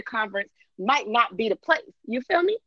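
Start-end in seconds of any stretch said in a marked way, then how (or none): none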